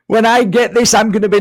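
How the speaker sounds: high, loud, hammed-up boasting; over-keen ego, pitched right up; mock eager ego. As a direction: imitate an ego getting carried away. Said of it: "the fears and the ego".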